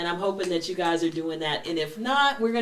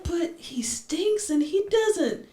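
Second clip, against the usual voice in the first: much higher, slow whispered complaint; complaining bride; 10 semitones higher, slower than usual. Retold or imitated. Young bride whining to her grandmother, high whisper. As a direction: whisper the gross secret, slowly. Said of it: "Jenny was like".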